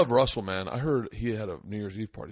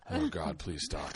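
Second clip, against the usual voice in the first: slow, soft, low retold plea; low pleading; 9 semitones lower, 7 dB quieter, slower than usual. Russell dreads the porn star story, pleading low and slow. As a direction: beg quietly under the breath.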